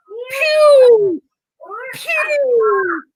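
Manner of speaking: high, loud pew pew sound effects; mock shooting noises. Giantess miming arrows killing the horse, high squeals. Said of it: "she goes".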